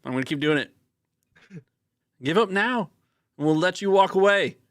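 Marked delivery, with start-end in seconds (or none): 2.2-4.6 high, loud put-on Aussie twang; terrible Australian accent. Kit calls out to Grizzle over the wind in a bad Australian accent. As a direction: play a tough Aussie calling over the wind. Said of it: "This is a terrible accent".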